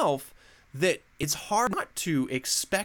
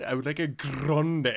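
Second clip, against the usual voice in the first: mock Italian accent. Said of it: "an accent to it".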